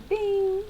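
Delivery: high, ringing imitated "bing"; gas light ding. Storyteller mimics the gas light warning, high chime. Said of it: "the little gas light was like".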